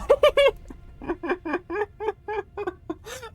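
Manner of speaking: long, very high squeaky laugh; drawn-out high-pitched giggling fit; giddy laugh. Real, not acted. Fan gushing over a villain, giddy high laughter.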